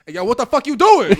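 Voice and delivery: loud tough-guy voice, a little put on; yelling through the window at an intruder in a tough man voice. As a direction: bark it at the stranger like a tough guy. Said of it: "I had to use my man voice".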